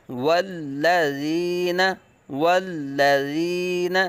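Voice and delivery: والذین with a brief hold on the doubled lam, no long stop; just touch the lam in والذین and move on; slight pause on lam. Shown as a demonstration. Teacher shows students the small pause in والذین. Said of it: "ذرا رکنا ہے".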